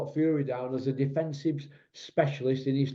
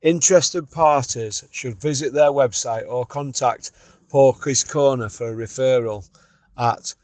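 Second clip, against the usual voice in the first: louder, slow, announcer-style ad read; sponsor ad read; 8 dB louder than usual. Acted. Podcast host reading the sponsor message, announcer style. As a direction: sell it like a radio sponsor spot.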